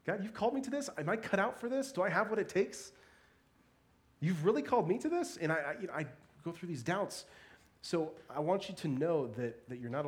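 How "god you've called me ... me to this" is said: high, disbelieving questions to God; voicing doubt, pitched well up; incredulous self-doubt. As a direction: question your calling in rising disbelief. Voices own doubting questions to God.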